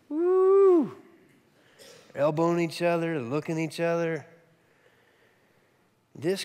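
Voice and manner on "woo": mocking whoop. Guests mock her arrival.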